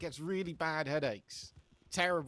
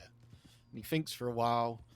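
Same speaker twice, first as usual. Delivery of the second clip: ordinary delivery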